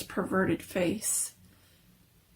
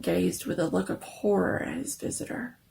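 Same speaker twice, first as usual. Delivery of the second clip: ordinary delivery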